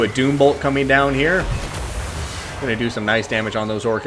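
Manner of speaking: energetic esports caster voice; live play-by-play. Acted. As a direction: call the battle like a live caster.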